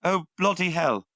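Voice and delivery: hammed-up British accent, pitched high; shitty British accent. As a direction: do a bad, unprepared British accent. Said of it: "so I apologize for my shitty British accent".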